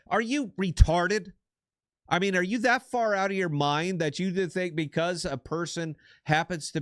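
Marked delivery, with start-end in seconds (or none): 0.1-3.4 higher and louder, incredulous snap; exasperated outburst; outraged retort. Real, not acted. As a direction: blast the question in disbelief. Angry rant at voter ID claim.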